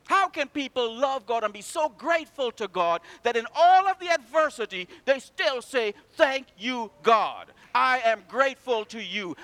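loud, rising sermon cry; preaching at full force. Acted. Preacher rousing the congregation, loud and fervent. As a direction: preach it out to the whole church.

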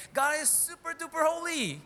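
high-pitched mock exclamation; hypothetical angel quote. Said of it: "Why can't he just say".

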